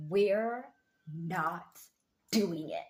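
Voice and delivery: we're, not, doing it, each word set apart by a pause and punched; firm emphatic refusal. Acted. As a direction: lay down the law word by word. Firmly refusing to people-please.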